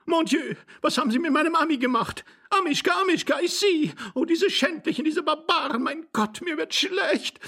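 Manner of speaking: very high, lamenting lady's voice; distraught lady. A narrator's reading. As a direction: wail in outrage in a high female voice. Lady laments her lost dog.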